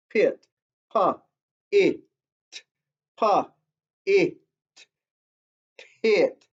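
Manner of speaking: "Pit" broken into "pa", "it", then blended back into "pit"; sounding out a word. Shown as a demonstration. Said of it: "Let's blend these together".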